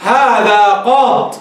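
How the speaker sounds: هذا قاض said very slowly, ending cut on the ض with no final ي; slow pausal form. Shown as a demonstration. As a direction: stretch out قاض and stop dead on the ض. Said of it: "فتقول".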